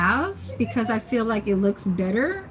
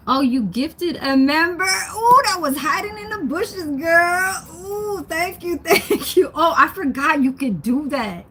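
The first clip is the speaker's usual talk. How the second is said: high, delighted squealing thanks; gushing over a surprise gift; excited squeal; 8 semitones higher than usual. Real, not acted. Streamer squealing thanks at a viewer's gift, delighted.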